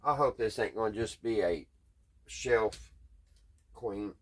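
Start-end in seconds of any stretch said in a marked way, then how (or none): none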